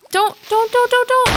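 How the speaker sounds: high, rapid-fire panicky repeats; panicked pleading. Real, not acted. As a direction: plead frantically with an approaching creeper. Pleading with an approaching creeper.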